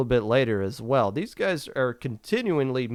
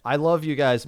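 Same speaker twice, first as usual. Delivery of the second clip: ordinary talk, nothing marked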